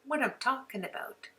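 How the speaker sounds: ordinary delivery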